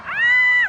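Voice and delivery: very high, loud cry; excited shriek. Real, not acted. Spectator watching the play unfold, excited shriek. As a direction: shriek at a near thing.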